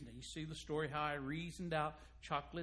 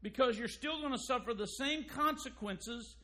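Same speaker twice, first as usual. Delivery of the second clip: preaching rebuke; 5 semitones higher, 4 dB louder than usual. Acted. Preacher rebukes faithful without expression.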